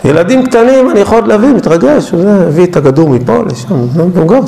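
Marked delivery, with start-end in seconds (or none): none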